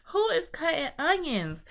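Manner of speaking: teary joke. Real, not acted. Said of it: "Baby, who cut onions?".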